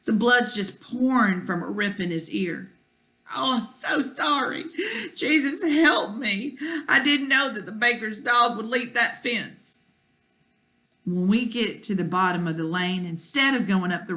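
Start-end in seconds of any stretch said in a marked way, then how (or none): 3.3-9.8 put-on high, tearful, pleading child; distraught apology. A narrator's reading. Child over his bleeding dog, tearful pleading apology. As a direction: plead through tears, voice pitched up.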